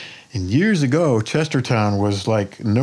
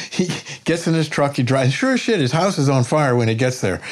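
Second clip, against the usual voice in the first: ordinary talk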